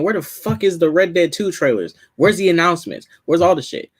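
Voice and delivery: impatient fans. Retold or imitated. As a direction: imitate exasperated fans demanding news. Voices impatient fans on Twitter.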